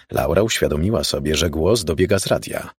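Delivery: plain reading voice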